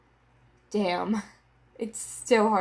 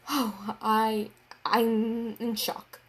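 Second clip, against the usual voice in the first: usual speaking voice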